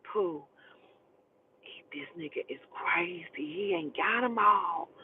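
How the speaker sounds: low, soft imitation of her; hushed confiding. Voicing woman confiding about man.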